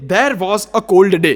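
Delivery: very slow, each word drawn out; teaching a foreigner his password, very slow; slow demo phrase. Shown as a demonstration. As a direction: spell the phrase out slowly for him. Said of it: "you have to say".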